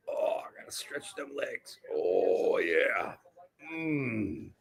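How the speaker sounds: breathy strained whisper while stretching. Real, not acted.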